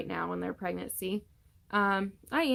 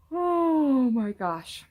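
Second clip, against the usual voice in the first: words stretched out through a big yawn, higher and louder; 6 semitones higher, 7 dB louder, much slower than usual. Real, not acted. Tired expecting mom yawning mid-update to viewers. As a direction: let a real yawn pull the words long. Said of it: "Why do I always yawn?".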